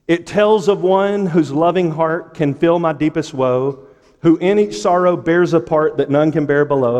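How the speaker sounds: impassioned hymn reading. Real, not acted. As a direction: declaim the verse in long driving lines. Reads hymn aloud to congregation.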